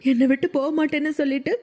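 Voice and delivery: high-pitched, acted crying complaint; hurt, weepy voice pitched well up; tearful reproach. A narrator's reading. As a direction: plead and accuse as if through tears. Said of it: "அழுது கொண்டே".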